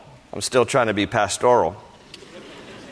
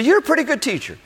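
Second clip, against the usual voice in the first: much higher mock voice of the stranger; imitating the man; 14 semitones higher, faster than usual. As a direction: play the eager fan high and bright. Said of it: "he says to me".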